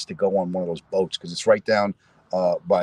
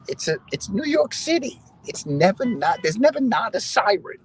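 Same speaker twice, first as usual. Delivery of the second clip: much higher, slower, whiny; exasperated whine; 14 semitones higher, 4 dB louder than usual. Retold or imitated. Son whining to his mother about New York sirens. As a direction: whine it out like a put-upon son.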